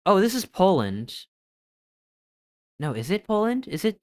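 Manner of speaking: ordinary talk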